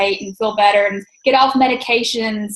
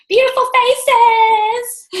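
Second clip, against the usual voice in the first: high, drawn-out singsong cheer; gushing cheer; 10 semitones higher, 4 dB louder, much slower than usual. Real, not acted. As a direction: gush it out slowly, high and playful. Calling out after team photo.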